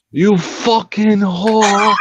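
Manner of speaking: slow, high mock British insult; mock British accent. Teasing friends by copying their British quarrels, slow fake British accent. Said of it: "in a British accent".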